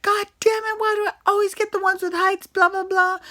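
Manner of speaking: mocking Scott. Mocking Scott griping about heights, high whiny voice. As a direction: whine it like a scared complainer. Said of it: "He's like".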